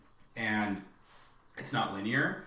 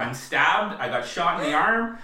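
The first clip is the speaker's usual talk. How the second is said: higher, louder put-on listing; mock breezy reporting; 6 semitones higher, 7 dB louder than usual. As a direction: rattle off disasters as mock small talk. Mock listing of injuries to neighbour.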